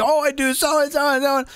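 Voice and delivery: high proud boasting; hammed-up bragging; boastful shout. Mocking proud impressionist.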